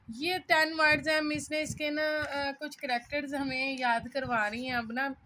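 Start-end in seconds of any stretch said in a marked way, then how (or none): none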